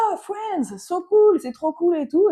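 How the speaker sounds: high mock-American excitement; imitated gushing enthusiasm. American hears they're from France, imitated high-pitched gushing. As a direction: gush high and loud, as an impressed stranger.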